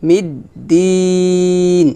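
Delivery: "মিদ্দিন" with a very long final vowel; chanted prolongation before the closing n; three-alif stretch. Shown as a demonstration. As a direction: hold the "দি" of "মিদ্দিন" on one level note. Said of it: "তিন আলিফ টান হবে".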